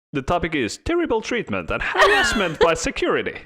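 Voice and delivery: high-pitched fancy British lady reading a complaint; British accent. Retold or imitated. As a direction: read it like an offended posh British lady. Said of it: "in British accent".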